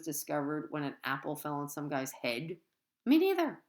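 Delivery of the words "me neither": high, loud quip; playful quip. A narrator's reading. Narrator admitting ignorance to readers, high and loud. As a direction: pop it out cheekily.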